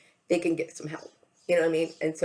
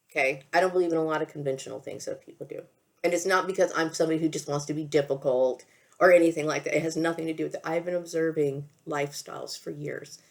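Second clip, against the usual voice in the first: normal talking voice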